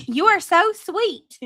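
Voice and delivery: high, slow, syrupy mock sweetness; mock sweet. Streamer mocking a woman's text to him, high and slow. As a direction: coo it like you're mocking her. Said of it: "she says".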